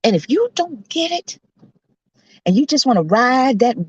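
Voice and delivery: high, emphatic exhortation; preacher's hollering. Real, not acted. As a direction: holler encouragement at the congregation. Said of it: "I'm gonna stop hollering".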